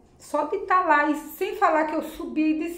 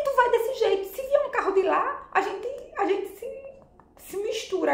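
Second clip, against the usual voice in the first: pitched way up, half laughing in alarm; alarmed high protest; 9 semitones higher than usual. Retold or imitated. Passenger warns the speeding driver about oncoming cars, alarmed and laughing. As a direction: squeal the warning at him, laughing nervously.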